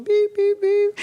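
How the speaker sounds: high, loud honking beeps; car horn beeps. Retold or imitated. Telling the surprise, he mimics the honking car.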